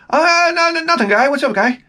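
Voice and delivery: very high mock older local; Cliff Clavin-like impression. Buddy's father answers a visitor, very high caricature voice. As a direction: exaggerate the buddy's father's greeting. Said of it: "he would say, "Guy".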